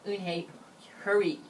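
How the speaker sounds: ordinary delivery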